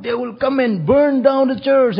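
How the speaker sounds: high, loud, slow alarm; high loud slow fearful warning; frightened warning. Retold or imitated. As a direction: warn in panic, slowly. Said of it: "I said".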